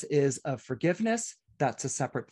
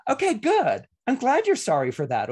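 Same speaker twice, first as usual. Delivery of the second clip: higher, louder, gloating okay good; smug retort; 8 semitones higher, 4 dB louder than usual. Acted. Receiver of an apology gloating back at the apologiser, hammed-up smug. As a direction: rub their apology in their face. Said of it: "you get to go".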